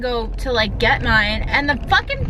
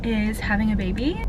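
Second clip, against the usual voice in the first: normal talking voice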